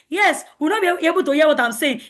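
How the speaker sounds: ordinary delivery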